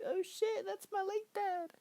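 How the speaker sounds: very high falsetto, imitating shock; mock shocked squeal. Ben's shock at seeing dad, imitated.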